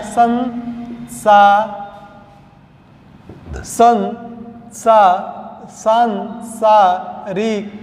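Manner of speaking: सांसारिक started slowly, संसा- held long; drawn-out dictation. Shown as a demonstration. Teacher dictating the correct form, very slowly. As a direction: stretch each syllable of संसारिक. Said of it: "होता क्या है".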